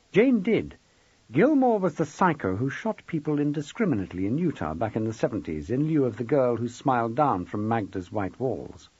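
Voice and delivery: usual speaking voice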